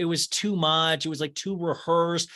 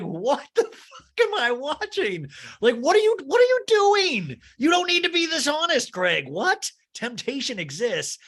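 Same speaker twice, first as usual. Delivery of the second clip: loud and much higher all through, half laughing; exasperated shouting; 10 semitones higher, 4 dB louder than usual. Real, not acted. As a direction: rant in disbelief at the TV. Host loses it at Craig's answer.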